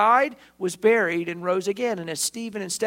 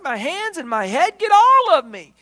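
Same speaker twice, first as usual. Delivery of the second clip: high, loud, overeager rush; dense eager Peter; 9 semitones higher, 8 dB louder than usual. Acted. Peter begging Jesus to wash all of him, overeager and loud. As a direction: play him thick and too keen. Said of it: "demonstrating the density of his head".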